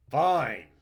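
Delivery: loud, high, bitter shout; an enraged parting yell; furious. Acted. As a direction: spit the words out and storm off. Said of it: "stood furiously".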